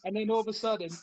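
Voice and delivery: usual speaking voice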